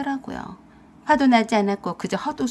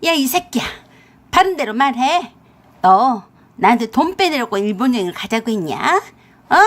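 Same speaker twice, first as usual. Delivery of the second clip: loud mother-in-law tearing into her son; shrewish, furious interrogation; sharp, fierce scolding; 6 dB louder than usual. Acted. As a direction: shout an accusation down the phone. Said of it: "시어머니 앙칼진 목소리를 듣더니".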